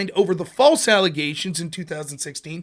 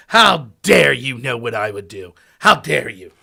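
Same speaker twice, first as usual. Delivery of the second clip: louder mock-indignant rant; hammed-up indignation; mock outrage; 4 semitones lower, 8 dB louder, slower than usual. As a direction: parody the offended debater, slowing on the first line. Mocking outraged opponent.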